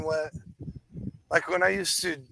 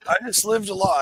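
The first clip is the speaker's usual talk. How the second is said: loud; 4 semitones higher, 6 dB louder than usual. Real, not acted. Repeats line.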